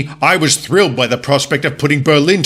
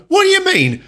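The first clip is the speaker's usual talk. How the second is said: ordinary delivery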